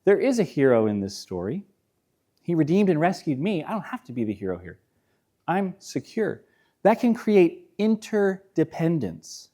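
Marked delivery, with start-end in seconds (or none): 0.0-6.4 softer, lower, calm release; a gentle, peaceful confession; soft surrender. Retold or imitated. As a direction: let go quietly and sincerely. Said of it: "The vulnerability says".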